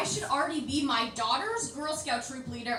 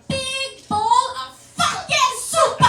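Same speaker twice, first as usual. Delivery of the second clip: singsong excited; 12 semitones higher, 8 dB louder, much slower than usual. Retold or imitated. Kid gleefully asking for soup.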